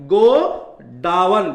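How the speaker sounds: "गोडाउन" drawn out very slowly as go... daa-wan; word broken up. Shown as a demonstration. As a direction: split "गोडाउन" after go, stretching each part. Teacher breaks up the corrected word. ("गोडाउन" को तोड़कर, खींचकर गो... डा-वन)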